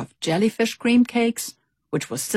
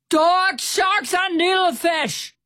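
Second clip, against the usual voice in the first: much higher, loud alarmed shout; 12 semitones higher, 4 dB louder, slower than usual. A narrator's reading. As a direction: yell the list out over the noise. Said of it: "Stout Sam shouted".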